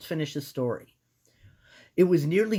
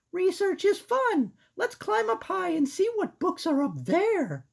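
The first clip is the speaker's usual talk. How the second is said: put-on squeaky girl mouse; high-pitched cartoon mouse. Voicing Sarah.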